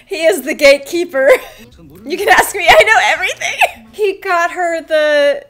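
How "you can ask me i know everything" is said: amused mock boast. Voicing the boastful gatekeeper, amused.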